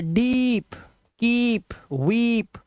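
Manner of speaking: डीप, कीप, वीप with long drawn-out "ee"; long vowel sound. Shown as a demonstration. Teacher giving examples डीप, कीप, वीप with a long vowel. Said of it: "long vowel sound".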